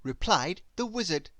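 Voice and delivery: ordinary delivery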